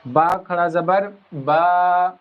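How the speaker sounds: teacher spelling out the long a, very slow; slow syllable-by-syllable recitation; slow drawn-out drill. Shown as a demonstration. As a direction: stretch the vowels of با and تا slowly. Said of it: "یا تک لے جا سکتے ہیں".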